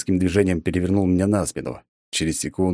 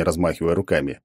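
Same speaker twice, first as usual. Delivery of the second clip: ordinary delivery, nothing marked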